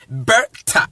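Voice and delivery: "Berta" split into "ber" and "ta", low; syllable demo. Shown as a demonstration. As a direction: sound out "Berta" in two separate syllables. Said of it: "Ber, because I am speaking from".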